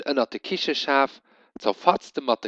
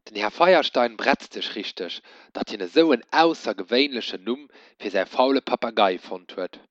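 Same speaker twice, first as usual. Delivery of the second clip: ordinary delivery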